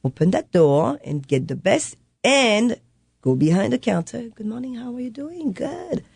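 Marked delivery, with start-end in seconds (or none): 4.3-6.0 high, very soft sing-out greeting; small, lifted customer-service voice; put-on cheery greeting. Mother behind the counter greeting customers, put-on cheery greeting.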